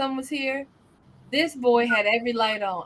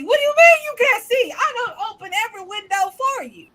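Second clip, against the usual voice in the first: mock indignant; 8 semitones higher, 3 dB louder than usual. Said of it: "He goes".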